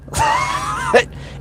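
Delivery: a sudden burst of very high laughter. Real, not acted. Streamer laughs at his name following him, high burst of laughter.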